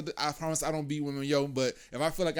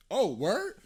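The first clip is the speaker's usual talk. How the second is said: mock surprise; 9 semitones higher, 4 dB louder than usual. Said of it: "and I was like".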